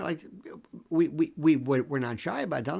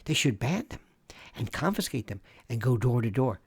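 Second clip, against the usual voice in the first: usual speaking voice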